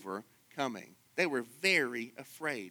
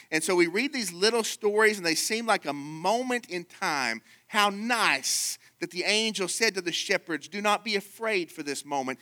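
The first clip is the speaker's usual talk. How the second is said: higher, pressing pulpit voice; fervent preaching; 5 semitones higher, 3 dB louder than usual. Acted. Preacher to his congregation about Jesus, fervent and raised. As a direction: preach it upward.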